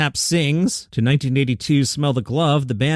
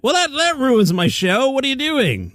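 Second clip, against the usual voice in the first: higher, playful outrage at the schedule; mock exasperation; 11 semitones higher than usual. Real, not acted. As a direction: act put out that the show is ruined. Host finds Florida State's game is off.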